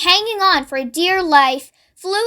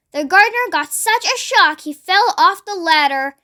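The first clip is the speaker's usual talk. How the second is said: ordinary delivery, nothing marked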